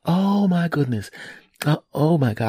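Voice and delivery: slow, high dismay; imitated shocked wife. Voices wife shocked by news.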